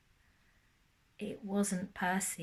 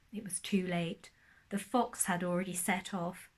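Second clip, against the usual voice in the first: ordinary delivery, nothing marked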